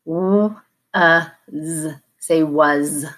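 "W", "uh", "Z", then "was" blended; teacher segmenting demo; sound by sound. Shown as a demonstration. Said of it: "the S is voiced as in Z".

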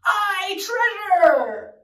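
hearty pirate cheer, loud on the last word; shouting. Acted. Reader leads listeners as the pirate crew, shouting. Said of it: "You shout it now".

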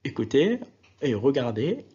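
ordinary delivery

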